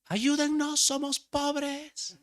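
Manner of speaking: put-on high, slow little girl's pleading cry; child calling out. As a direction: beg the street like a small girl. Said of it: "ella empezó a gritar". (niña gritando que son pobres)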